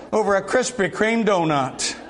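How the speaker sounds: ordinary delivery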